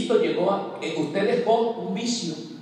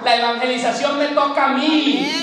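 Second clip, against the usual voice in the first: louder, higher preaching climax; predicación con fuerza; 5 semitones higher, 5 dB louder than usual. Real, not acted. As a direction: drive it home to the congregation. (predicador exhortando a los hermanos con fuerza)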